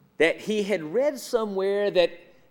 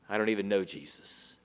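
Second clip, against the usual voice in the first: quick, much softer and lower mumbled denial; nervous denial; 11 semitones lower, 10 dB quieter, faster than usual. Acted. Peter denying Jesus to a bystander, quick low mumble. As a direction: brush it off fast under your breath.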